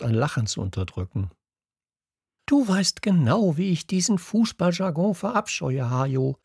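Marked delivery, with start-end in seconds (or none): 2.4-6.4 high-pitched, indignant outburst; exclaiming class teacher. A narrator's reading. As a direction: cry out your loathing to a colleague. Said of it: "rief unser Klassenlehrer". (empört und hoch ausgerufen)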